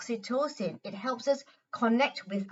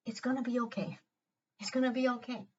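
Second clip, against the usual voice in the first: much softer, reassuring inner voice; gentle heart voice; 2 semitones higher, 7 dB quieter, faster than usual. Shown as a demonstration. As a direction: comfort like a kind heart.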